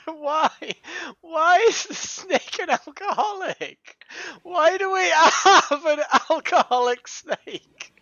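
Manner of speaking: slow, high, giggling through the question; can't believe it, laughing; laughing disbelief. Real, not acted. Player laughing at the game's alcoholic snake, giggling disbelief.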